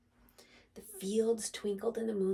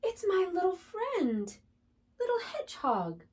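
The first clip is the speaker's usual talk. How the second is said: high, louder cry of joy; excited cry; 10 semitones higher, 6 dB louder than usual. Acted. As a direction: cry out in relief and delight. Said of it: "cried Rabbit".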